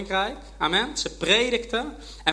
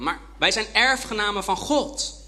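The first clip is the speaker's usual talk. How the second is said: normal talking voice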